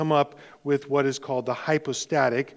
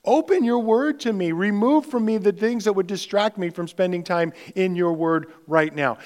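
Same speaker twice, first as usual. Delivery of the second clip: a raised, earnest prayer voice; demonstrated prayer; 5 semitones higher, 4 dB louder than usual. Retold or imitated. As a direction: pray it aloud as an example. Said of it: "I say".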